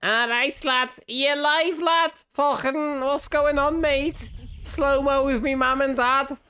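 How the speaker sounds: high, hammed-up Scouse accent. Streamer voicing Henry to his mum in a mock Scouse accent. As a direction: play a cheeky Liverpool lad in a Scouse accent. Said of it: "The reason I started doing Scouse accent".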